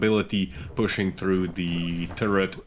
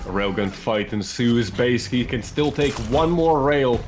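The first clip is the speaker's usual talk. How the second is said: building excited match commentary, rising and louder; live esports play-by-play; 3 semitones higher, 4 dB louder than usual. Real, not acted. Caster calling a railgun fight to viewers, building play-by-play. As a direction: call the duel, pitch climbing to the last word.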